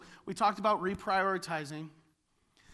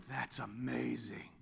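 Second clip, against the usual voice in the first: awed hush; 11 semitones lower, 11 dB quieter than usual. Retold or imitated. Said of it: "Then you're like".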